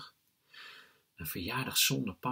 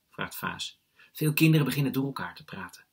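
ordinary delivery, nothing marked

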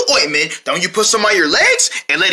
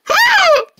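laugh. Pretend. Laughs at spy game.

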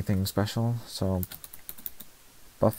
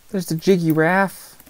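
silly high voice; 10 semitones higher, 4 dB louder than usual. Real, not acted. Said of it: "weirdest phrase I've ever said".

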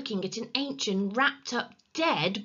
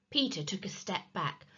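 usual speaking voice

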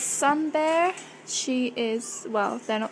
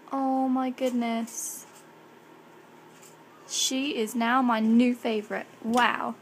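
normal talking voice

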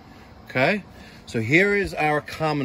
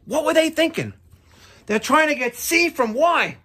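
incredulous; 13 semitones higher, 4 dB louder than usual. Real, not acted. Scoffs at the bad wiring.

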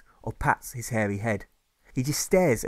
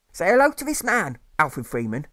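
high, louder motherly voice; mother's voice; 5 semitones higher, 4 dB louder than usual. Acted. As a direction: play the mother introducing her son. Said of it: "his mother says".

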